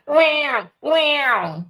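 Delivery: high, short mock baby cries wham wham; imitated baby wail. Mimicking the new baby crying to her viewers, mock wail. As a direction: squall like a bawling baby, half joking. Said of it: "Y'all know how them baby crying".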